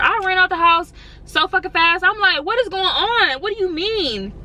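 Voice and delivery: high, startled disbelief, a little breathless; rushing out asking what's going on; alarmed and confused. Retold or imitated. Girlfriend bursting out of the house, alarmed and high.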